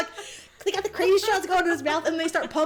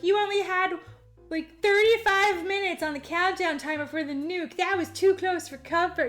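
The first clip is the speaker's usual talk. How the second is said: put-on higher-pitched voice for the girl; girl character; 4 semitones higher than usual. Girl to the man about the countdown, raised character voice. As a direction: lift the voice into a mock girl's remark. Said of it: "like the girl is like".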